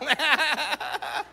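bursting into laughter; a real burst of laughter at the punchline. Real, not acted. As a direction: let the laugh break out high. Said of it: "he couldn't help himself".

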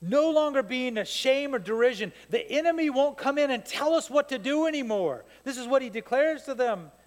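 normal talking voice

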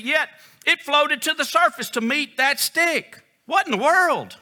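loud, high, incredulous preacher; baffled amazement. Real, not acted. Preacher marvels to congregation at the floating axe head, baffled amazement. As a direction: exclaim it in high-pitched, forceful disbelief.

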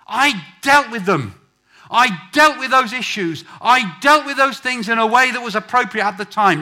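very loud, high, exhorting shout; full-force preaching. Real, not acted. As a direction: thunder it out to rouse the congregation.

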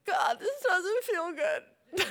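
high, slow whimper; acted whiny plea to God; tearful complaint. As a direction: whimper it slowly, like a crying child.

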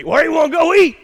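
put-on playful Mississippi southern accent. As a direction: ask it like a Mississippi husband. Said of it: "that's how we do it in Mississippi".

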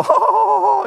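high teasing mock laugh; high, light chuckle; imitating own laugh. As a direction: laugh back at her, playful. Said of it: "and I'd go".

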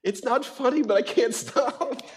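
very high, laughing, slow; high, slow laughing apology to the kids; laughing, can't stop. Retold or imitated. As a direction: giggle through it helplessly. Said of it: "I'm like".

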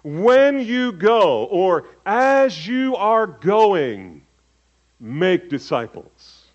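preacher slowing to show the true sense; slow, measured translation. Shown as a demonstration. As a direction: spell out the participle slowly. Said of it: "better translated".

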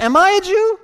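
high, loud indignant question; getting mad. Retold or imitated. Preacher voices Pilate answering Jesus, mad and loud. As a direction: fire it back in acted outrage. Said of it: "Pilate's getting mad".

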